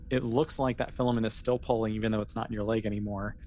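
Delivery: normal talking voice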